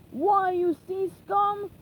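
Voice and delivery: furious pirate captain; angry pirate. Acted. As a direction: spit it out as an insult. Pirate swatting seagulls.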